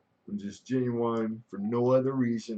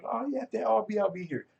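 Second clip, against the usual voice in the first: chirpy reply; 12 semitones higher than usual. Retold or imitated. Imitating the other side's reply.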